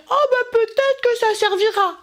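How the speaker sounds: high, wavering maybe-I'll-keep-it voice; hesitant hoarder. Retold or imitated. Mocking a hoarder who won't throw things away, high voice.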